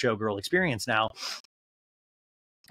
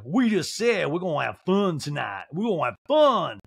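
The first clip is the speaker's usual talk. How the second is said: mock protest with stretched vowels; Sutton; 4 semitones higher, 3 dB louder than usual. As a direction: build louder on the repeat. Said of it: "And Sutton's like".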